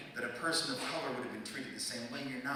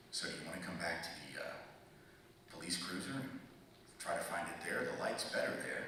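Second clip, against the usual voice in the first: calm cop; 7 semitones lower, 9 dB quieter than usual. Retold or imitated. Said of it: "And at one point he says".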